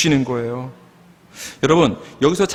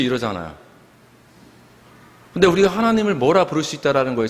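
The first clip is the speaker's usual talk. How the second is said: normal talking voice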